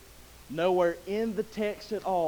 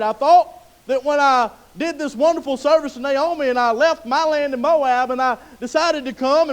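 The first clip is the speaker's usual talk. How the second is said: Ruth's complaint; 6 semitones higher, 10 dB louder than usual. Acted. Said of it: "Ruth here could have been like".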